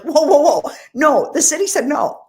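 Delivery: high, loud laughing whoa; shocked outcry. Retold or imitated. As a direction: retell your own disbelief with a big laughing burst. Retold shock at friend's words.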